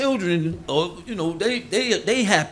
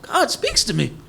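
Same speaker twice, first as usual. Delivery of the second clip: higher, smug mock holiness with a laugh; hammed-up self-important believer; over-righteous persona; 7 semitones higher than usual. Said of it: "we get over-righteous".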